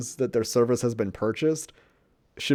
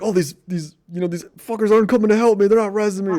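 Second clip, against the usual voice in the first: higher, hurried mock complaint; griping teammate imitation; 7 semitones higher, faster than usual. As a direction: gripe like an indignant gamer left for dead. Imitates his friend griping at teammates.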